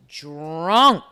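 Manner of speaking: drawn out. Real, not acted. Recapper revealing the husband is drunk, drawn out. Drags out word for effect.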